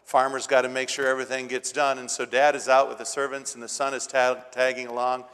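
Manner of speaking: ordinary delivery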